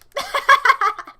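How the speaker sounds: high, loud run of laughter; loud cackle. Real, not acted. Streamer teasing a viewer, loud real cackle. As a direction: crack up with a pealing cackle.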